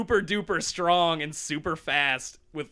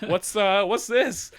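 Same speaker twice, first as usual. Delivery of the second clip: high, louder, faltering question; mock alarm; 5 semitones higher, 5 dB louder than usual. Friends reading the diary, mock alarm. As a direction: stammer it in uneasy confusion.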